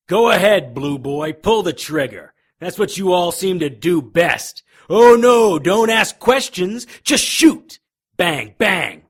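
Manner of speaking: high, loud enraged taunting; furious taunt. Acted. Wounded detective taunting the officer aiming at him, furious shouting. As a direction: spit contempt at the officer holding the gun.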